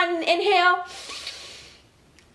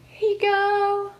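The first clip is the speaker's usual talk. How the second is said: gentle offering; 6 semitones higher, slower than usual. Acted. Voicing Rosa giving a drink.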